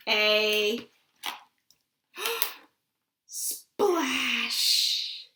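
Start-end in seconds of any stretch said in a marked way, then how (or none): none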